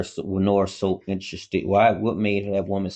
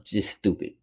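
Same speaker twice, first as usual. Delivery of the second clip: usual speaking voice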